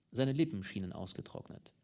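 ordinary delivery

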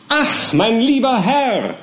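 high, slow, delighted little lawyer; fussy little lawyer crying out in high delight; high exclamation. Acted. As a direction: sing out a high, drawn-out greeting. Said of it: "rief der kleine Mister Perker".

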